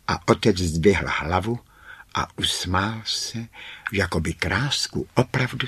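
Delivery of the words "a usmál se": plain reading voice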